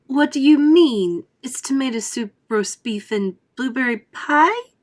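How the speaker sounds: put-on high, loud, slow incredulous girl; bratty girl. Violet questioning Wonka, high and incredulous. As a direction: drag out her disbelief like a spoiled kid.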